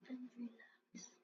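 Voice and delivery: breathy whispered aside. Real, not acted. Player settling down mid-game, soft whisper. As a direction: whisper it to yourself, winding down.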